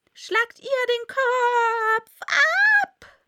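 very high, slow furious scream. A narrator's reading. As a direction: shriek the execution order. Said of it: "zu zanken und zu schreien".

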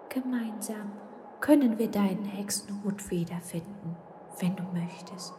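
a gentle secret promise; whispering kind ghost. Acted. Ghost offering the witch help in a soft whisper. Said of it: "sagte der gute Geist".

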